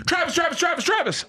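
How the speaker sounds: high, carrying shout of a name; calling out. Retold or imitated. Reporter yelling for a player in the stands. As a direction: yell for his attention. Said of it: "I had more fun going".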